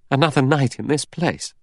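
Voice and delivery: a high-pitched cry of protest; dismayed cry. A narrator's reading. Vera protests another night on the island, dismayed cry. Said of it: "Vera cried".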